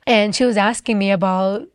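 usual speaking voice

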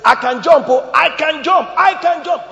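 boastful bragging. Acted.

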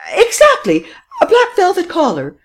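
very high, loud, triumphant cry; triumphant exclaim. A narrator's reading. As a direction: crow it out, vindicated. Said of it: "exclaimed Madame Renaud triumphantly".

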